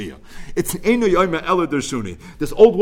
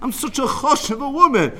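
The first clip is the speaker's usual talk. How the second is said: put-on indignant old woman; 10 semitones higher than usual. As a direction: play the insulted old woman demanding respect. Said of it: "She says".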